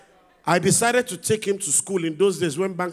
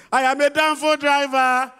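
son proudly declaring; 6 semitones higher, 3 dB louder than usual. Acted. Son's proud answer voiced.

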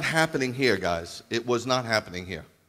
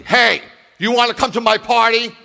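much higher, much louder, pushy; pushy shouting invite; 12 semitones higher, 12 dB louder than usual. Shown as a demonstration. Preacher mocking a pushy shouted party invitation. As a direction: bark the invitation at them. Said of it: "You don't invite somebody".

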